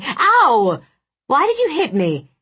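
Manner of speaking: high, loud, indignant cry; mock-hurt outburst; pained yelp. A narrator's reading. Acted yelp after being struck.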